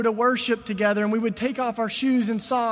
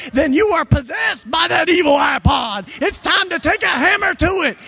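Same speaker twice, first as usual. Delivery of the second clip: loud, high, driving sermon voice; full-force preaching; 5 semitones higher, 7 dB louder than usual. Acted. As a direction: hammer the point home to the crowd.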